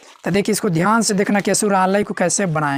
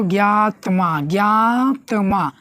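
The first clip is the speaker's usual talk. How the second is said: ज्ञात्मा drawn out slowly, syllable by syllable; slow sounding out; much slower than usual. Shown as a demonstration. Teacher spelling a word aloud to the class, slow. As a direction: stretch ज्ञात्मा so students hear each part. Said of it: "teacher sounding out the word".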